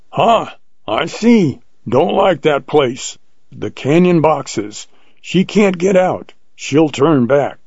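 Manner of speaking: high-pitched, drawn-out hunter's voice; old hunter sizing up. A narrator's reading. Old hunter judges the lioness's escape, high and emphatic. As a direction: raise the pitch, stretch the short phrases. Jones sizes up the cornered lioness.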